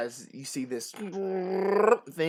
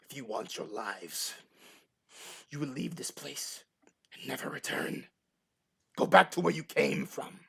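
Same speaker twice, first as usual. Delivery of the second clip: menacing sorceress. Acted. Villainess threatens the party.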